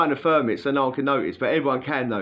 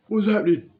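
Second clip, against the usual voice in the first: much higher, louder mock pal voice; imitating the pal; 10 semitones higher, 6 dB louder than usual. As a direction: bark it as the rowdy cellmate pal.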